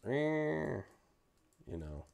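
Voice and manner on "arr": annoyed growl. Retold or imitated. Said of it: "you hear this".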